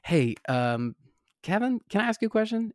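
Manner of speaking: quick, high-pitched, hesitant ask; tentative approach. Retold or imitated. Actor approaching Kevin Kline off the elevator, tentative. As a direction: sheepishly ask a star for a moment.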